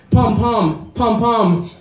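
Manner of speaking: high-pitched imitated sound word, said twice; Chinese onomatopoeia. Shown as a demonstration. Comedian quizzing the audience on a Chinese sound word, high-pitched imitation. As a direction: pop out each pair high and evenly. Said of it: "there's a Chinese onomatopoeia".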